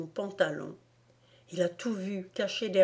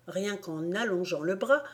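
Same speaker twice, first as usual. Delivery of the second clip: ordinary delivery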